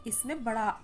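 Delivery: ordinary talk, nothing marked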